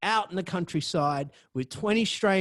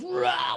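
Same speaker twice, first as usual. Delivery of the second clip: wild yell; 12 semitones higher than usual. Retold or imitated. Imitating a tripping dude.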